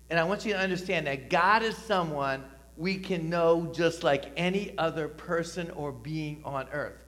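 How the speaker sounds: ordinary talk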